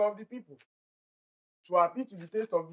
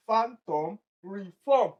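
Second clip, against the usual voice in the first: ordinary talk, nothing marked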